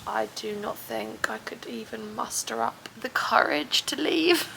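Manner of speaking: unvoiced whisper about the cold wind; hushed whisper in a quiet public spot. Real, not acted. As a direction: whisper so nobody nearby hears.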